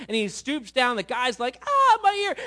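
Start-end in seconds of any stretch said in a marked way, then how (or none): 1.7-2.4 high, very loud comic wail; hammed-up cry of a wounded man; mock pained scream. Man whose ear Peter cut off, mock scream.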